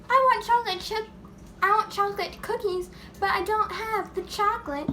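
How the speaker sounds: high, loud mock demand; imitating cookie lady. Lady in the story wanting chocolate cookies, mock-demanding. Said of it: "so this lady, she was like".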